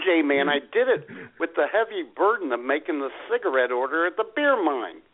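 ordinary delivery

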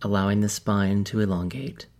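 calm, measured meditation guide with long pauses; softened, even delivery, leaving space between instructions; guided meditation. Real, not acted. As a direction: ease listeners into stillness, phrase by phrase.

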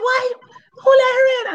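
hammed-up indignant shriek; mock shocked yell. Girlfriend shrieking who let her in. Other woman yelling in shock.

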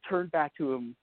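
ordinary talk, nothing marked

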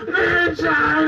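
high, slow, drawn-out shouted cry; wrestling battle cry. Retold or imitated. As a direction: bellow it as a finishing-move call. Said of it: "That was my finishing move".

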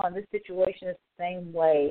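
ordinary delivery

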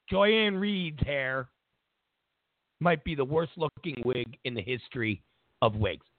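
usual speaking voice

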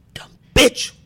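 loud, high mock outburst; scolding shout. Bag boy behind cashier correcting her, scornful shout.

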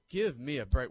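loud give-me-a-break scoff; scoffing outburst. Real, not acted. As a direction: blurt it out in disbelief. Scoffing at the movie's realism.

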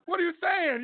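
high, fast and disbelieving; incredulous outburst. Retold or imitated. Scoffing at the news report in high, fast disbelief. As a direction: pitch it way up in disbelief.

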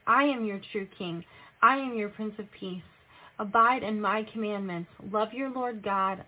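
usual speaking voice

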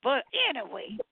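high-pitched, chatty church-lady voice; a high sister fussing cheerfully at the room; Sister Peppercorn. Acted. Greeting the callers as Sister Peppercorn, high and chatty.

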